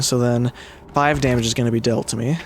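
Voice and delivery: ordinary delivery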